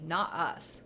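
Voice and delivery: ordinary delivery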